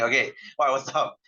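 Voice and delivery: usual speaking voice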